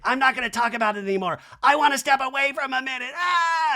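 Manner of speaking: high, loud, fast mock Kyle; hammed-up Kyle storming off. Recapping Kyle leaving the group in a loud mock wail. Kyle voiced, starts crying.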